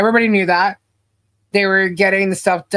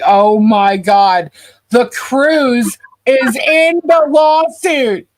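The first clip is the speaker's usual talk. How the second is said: surprised; 5 semitones higher, 5 dB louder, much slower than usual. Real, not acted. Finds cruise named in lawsuit.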